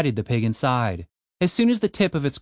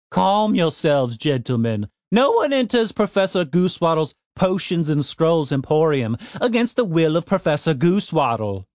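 grand gnome professor; 4 semitones higher than usual. Acted. Professor proclaiming.

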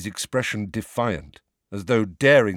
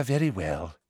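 soft, resigned and weary; quiet weary. A narrator's reading. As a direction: let it drop away tiredly. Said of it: "sounding slightly weary".

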